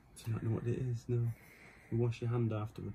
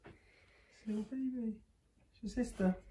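soft high cooing; 9 semitones higher, 8 dB quieter than usual. Real, not acted. Introducing the baby to the dog in soft high coo.

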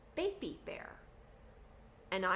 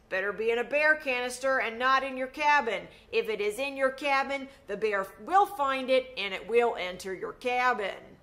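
ranger voice; 5 semitones higher, 9 dB louder than usual. Retold or imitated. A ranger warning campers about bears, in a loud, high, earnest voice. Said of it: "Ranger Dan at the ranger talk said this".

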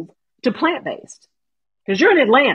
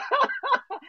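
high giggle; 6 dB quieter than usual. Real, not acted. Laughing at own fangirling.

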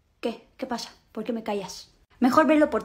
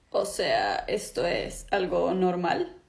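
much lower, slow; low drawling voice; 9 semitones lower, 8 dB quieter, much slower than usual. Acted. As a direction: speak deep and slow in disbelief. Visitor shocked at attack.

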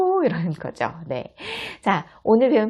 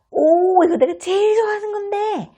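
put-on much higher, louder delighted kid; excited child; 5 dB louder than usual. Child sees favorite food, high excited squeal. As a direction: squeal with joy over a favorite dish.

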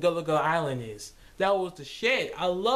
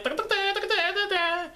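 high sing-song da da da; chicken walking tune; 12 semitones higher than usual. Retold or imitated. Chicken strolling along, high sing-song da da. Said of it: "chicken just walking around like".

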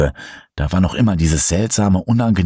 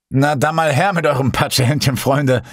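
hoch und verspielt geflötet; freudig flötend; 8 semitones higher than usual. A narrator's reading. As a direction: wie ein aufgedrehter Gastgeber trällern. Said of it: "flötete Ben freudig erregt". (hoch, freudig erregt flöten)

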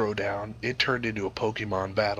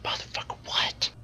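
whispered disbelief; stunned whisper. Retold or imitated. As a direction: whisper it in shock. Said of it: "I was like".

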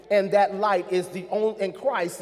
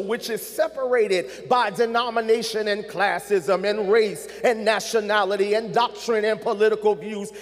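ordinary delivery

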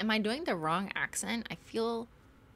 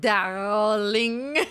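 put-on French accent; 3 semitones higher, 10 dB louder than usual. Beloved remembered saying darling, hammed-up French accent. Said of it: "saying your lovely French accent".

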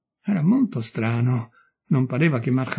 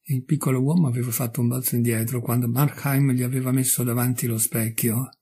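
plain reading voice

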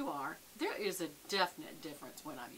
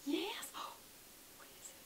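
whisper; 11 semitones higher, 3 dB quieter than usual. Real, not acted. Whispering to her dog.

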